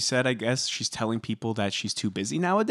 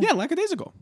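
much higher, defensive yeah; high-pitched; faster than usual. Real, not acted. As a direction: squeak it out in defence. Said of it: "Lackadaisical? Is that a word?".

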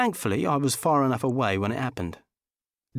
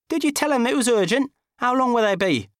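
high, loud fretful voice; anxious Dickie; 12 semitones higher, 4 dB louder than usual. Acted. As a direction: pester her for news.

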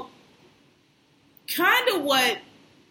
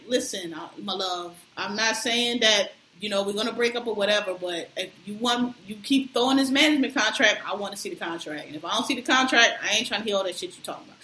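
real calm; 4 semitones lower than usual. Retold or imitated. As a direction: keep it low and level like a calm girlfriend. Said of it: "And she was real calm".

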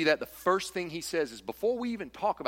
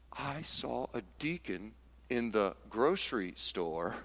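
scandalized hush; 6 semitones lower, 6 dB quieter, slower than usual. Retold or imitated. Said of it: "And they're like".

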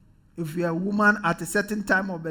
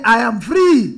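loud, bold declaration; rousing shout for the congregation to echo; positive attitude; 9 dB louder, slower than usual. Shown as a demonstration. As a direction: shout it out with conviction. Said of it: "With a positive attitude".

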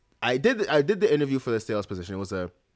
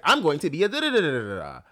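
higher, singsong stock answer; mock eager candidate; mocking imitation; 6 semitones higher than usual. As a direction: parody a rehearsed interview answer, trailing into babble. Mocks everyone's stock interview answer.

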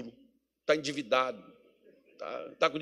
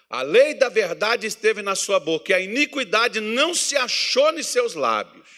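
loud, high, full-force preaching; fiery pulpit preaching; 10 semitones higher, 10 dB louder, slower than usual. Acted. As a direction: drive the point home to the congregation.

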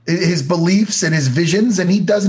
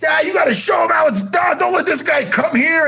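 unprofessional ring announcer yell; 12 semitones higher than usual. Retold or imitated. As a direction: bellow it like a biased announcer cheering his buddy. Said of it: "So he's up there being like".

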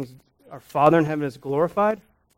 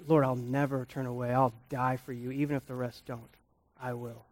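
very soft, low-key recalled pledge; hushed vow; 3 semitones lower, 12 dB quieter than usual. Retold or imitated. Preacher recalling Peter's promise to the Lord, hushed. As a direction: say the acted promise quietly, fading to almost nothing.

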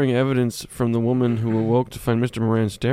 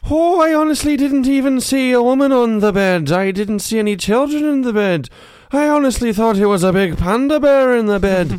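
loud, high put-on character voice; storyteller from Jaws; 10 semitones higher, 5 dB louder than usual. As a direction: plead your case in a loud, high tall-tale voice. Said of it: "You sound like you're from Jaws".

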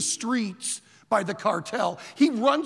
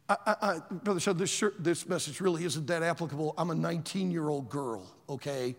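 ordinary delivery

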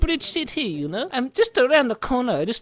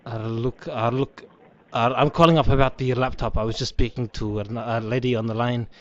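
much lower put-on man's voice, casual; different male caller; 9 semitones lower, 3 dB louder than usual. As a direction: drop the voice low to play a new caller asking about laptops. Prankster returns as different caller.

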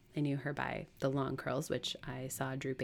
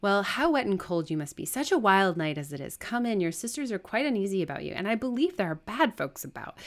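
higher, loud, agitated welcome; fussing servant; 5 semitones higher, 7 dB louder than usual. Acted. Servant welcoming the master from the storm, flustered. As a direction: fuss over the late arrival with lively emphasis.

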